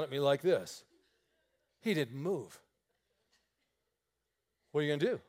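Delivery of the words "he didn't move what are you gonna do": normal talking voice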